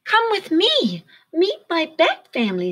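put-on high, bright young bat; Stellaluna voice. A narrator's reading. Stellaluna inviting the birds home, high eager voice. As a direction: invite them in a high little voice. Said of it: "said Stellaluna".

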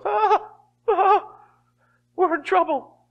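high-pitched, panicked mock cries; alarmed wail. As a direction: cry out in exaggerated alarm. Acting a person under the devil's attack.

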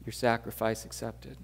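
soft, hushed prayer voice; quiet prayer. Acted. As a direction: pray softly to God. Closing prayer before the sermon.

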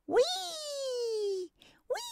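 long high gleeful whee. Acted. Foxes on the coaster crying out high with glee. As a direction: sing out the ride's thrill high and long.

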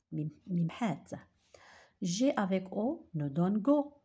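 normal talking voice

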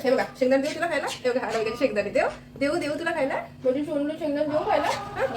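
high coaxing pet talk. Real, not acted. Owner offering peanuts to Anamika in high coaxing baby talk. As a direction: coax the bird with peanuts, sing-song.

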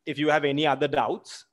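ordinary talk, nothing marked

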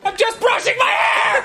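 high, shouted bad excuse; panicked comic yell through the door; super frantic. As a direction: cover up loudly and desperately. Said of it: "Super frantic".

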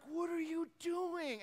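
high, drawn-out disbelief; incredulous demand. Retold or imitated. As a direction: confront a kid caught doing something absurd. Retold confronting snorkeling kid.

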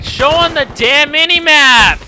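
high, loud exasperated yell; frustrated shout. Real, not acted. Player yelling at the minimap, frustrated. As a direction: demand it angrily at high volume. Furious at the game.

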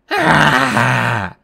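loud, raw cry; yell of pain and rage. A narrator's reading. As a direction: cry out after being whacked. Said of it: "Medusa yelled".

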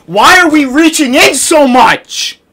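high, slow exasperated demand; exasperated scolding. Real, not acted. Player to teammates reaching in, exasperated scolding. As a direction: complain loudly at careless teammates.

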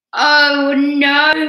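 loud, high cry of dismay; exasperated bear. A narrator's reading. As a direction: moan as the sleepless bear. Garden noises wake bear.

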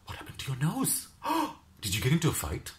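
high, surprised concern; shocked client. Retold or imitated. Client gasping at her injured nose, high and shocked. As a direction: gasp at the wound in mock alarm.

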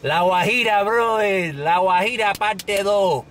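hammed-up Spanish accent on La Guajira; Spanish pronunciation. Shown as a demonstration. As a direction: show off a thick Spanish accent. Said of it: "I know it's pronounced".

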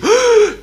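loud high gasp; shocked gasp. Acted. Cowboy hears a kid has a cavity: loud shocked gasp. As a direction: gasp in alarm at the news.

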